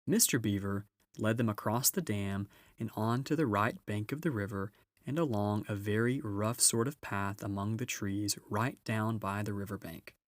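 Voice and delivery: usual speaking voice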